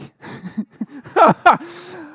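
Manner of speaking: high, loud laugh breaking out; can't hold back the laugh; real amused laughter; burst of laughter. Real, not acted. Coach laughing at girl's revenge plan.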